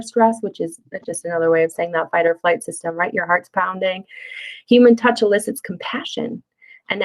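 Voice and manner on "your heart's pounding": ordinary delivery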